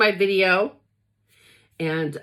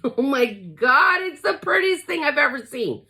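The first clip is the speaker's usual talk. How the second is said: laughing delight; 6 semitones higher than usual. Real, not acted. Laughs, overwhelmed by beauty.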